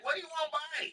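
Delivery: high-pitched impression of a brusque street seller; loud Chinese vendor. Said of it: "he loud and shit".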